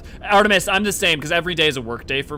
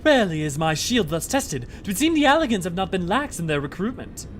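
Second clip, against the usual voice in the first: higher, louder formal acted voice; battling game character; 6 semitones higher, 4 dB louder than usual. Defender remarking on the Alligans' recruitment, lofty declaiming. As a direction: declaim it loftily in a raised pitch.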